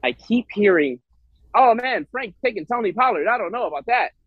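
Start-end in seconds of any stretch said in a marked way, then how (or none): 1.6-4.1 lightly mocking old-timey newsman, pitched up; a higher, hint of a vintage newscaster; old-timey news person. Host imitating co-host doubting Frank's pick, as a mild old-timey news voice. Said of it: "sound like an old-timey, like, news person".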